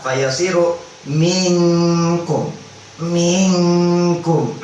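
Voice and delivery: slow chanted tajwid reading; Quran recitation. Shown as a demonstration. Teacher reciting the example for students, slow chant. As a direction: draw the verse out slowly.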